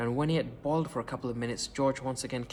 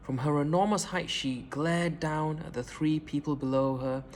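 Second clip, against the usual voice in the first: usual speaking voice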